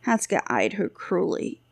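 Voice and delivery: plain reading voice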